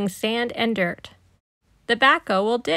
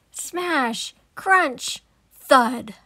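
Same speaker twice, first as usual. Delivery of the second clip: crash noises; 3 semitones higher, much slower than usual. Acted. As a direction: let it all come crashing down. Playground crashes down.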